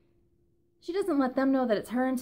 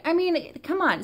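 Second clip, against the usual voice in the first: exasperated; 8 semitones higher, 5 dB louder than usual. Real, not acted. Ranting about show annoyance.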